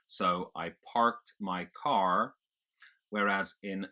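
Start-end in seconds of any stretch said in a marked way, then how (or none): 0.6-2.3 slow General American, R sounded in parked and car; American rhotic accent. Shown as a demonstration. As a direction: pronounce every R, American style. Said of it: "you pronounce it".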